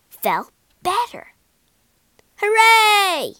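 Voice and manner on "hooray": high, loud cheer; joyful cry. A narrator's reading. Egbert cheering because his nose is cured, high and loud. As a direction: shout it out with glee. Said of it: "he cried".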